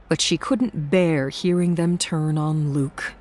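ordinary delivery

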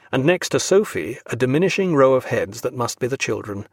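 ordinary delivery, nothing marked

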